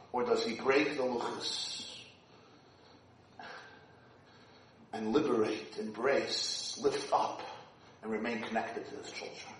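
soft, low, fading voice; hushed. Acted. Rabbi describing Moshe's choice, hushed and low. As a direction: drop almost to a hush.

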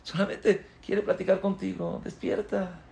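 suave, casi en voz baja; tranquilizando. Real, not acted. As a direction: calma al oyente con dulzura. Tranquiliza al oyente. (el predicador calma al oyente asustado, en voz baja)

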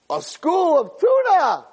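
high, stretched-out sighting cry; excited fisherman. Acted. Fisherman spots a school of tuna, high excited shout. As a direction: call out the catch, drawing it out.

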